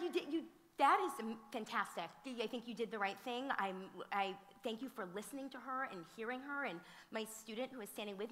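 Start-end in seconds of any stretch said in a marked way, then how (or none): 0.0-6.7 excited praise. Retold or imitated. Praising the boy warmly.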